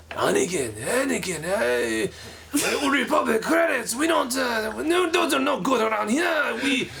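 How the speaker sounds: high, raspy alien shopkeeper voice; hammed-up Star Wars junk trader; Watto. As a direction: push the voice up into a pushy junk dealer. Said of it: "you wanna hear my Watto".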